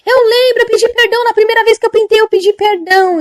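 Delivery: put-on high, loud, pleading old lady voice; a frightened old woman confessing; frantic old woman. Old woman confessing to the angel, high and pleading.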